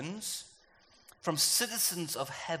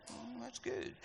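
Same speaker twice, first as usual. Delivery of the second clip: savoring; 6 semitones higher, 13 dB quieter, slower than usual. Pretend. Savoring the compliment.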